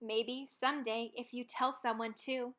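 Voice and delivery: ordinary delivery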